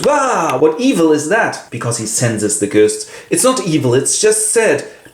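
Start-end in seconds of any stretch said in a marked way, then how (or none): 0.0-1.7 spooked Paul. Acted. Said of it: "he goes". 3.3-5.1 Seth reassuring. Acted. Narrator voices Seth answering Paul.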